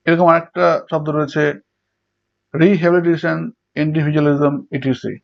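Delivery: ordinary talk, nothing marked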